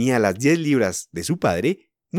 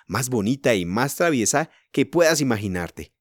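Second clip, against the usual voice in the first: ordinary delivery, nothing marked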